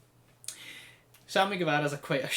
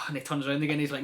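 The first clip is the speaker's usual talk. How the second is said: ordinary talk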